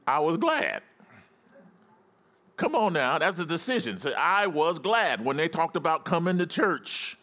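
high, driving sermon exhortation; fired-up preaching. Real, not acted. Pastor rallying congregation to go to church, fired-up preaching.

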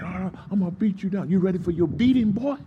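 high, mock-aggressive taunting; trash talk. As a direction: act out a bully taunting before a fight. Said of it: "talking trash".

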